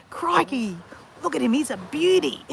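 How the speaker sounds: high, hammed-up Aussie wildlife presenter; Steve Irwin. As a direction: exclaim it in an exaggerated Australian accent. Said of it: "What does he say?".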